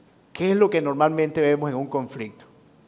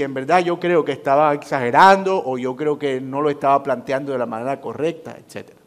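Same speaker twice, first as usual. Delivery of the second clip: ordinary talk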